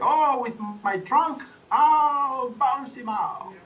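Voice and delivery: hammed-up elephant bragging; elephant boast. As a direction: stretch it out like a big proud elephant.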